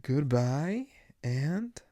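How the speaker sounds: each word swooping up high at the end; sing-song farewell. Acted. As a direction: draw it out with a rising lilt. Playful sing-song sign-off.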